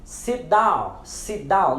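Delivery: "sit down" with T unreleased before D; silent final T. Shown as a demonstration. Teacher models "sit down" with silent T. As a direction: run "sit" straight into "down". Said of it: "silenciamos o T quando a palavra termina".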